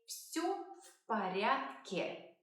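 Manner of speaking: slow, careful teacher's model; slow model phrase. Shown as a demonstration. Teacher modelling the answer slowly. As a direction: say it slowly for learners. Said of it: "It is very common to say".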